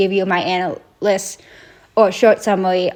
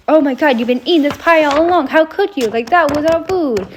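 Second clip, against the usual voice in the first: lightly acted indignant accusation, pitched up; mock outrage; 6 semitones higher than usual. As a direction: accuse like a betrayed friend, higher voice. Mouse confronts cat over eaten pie.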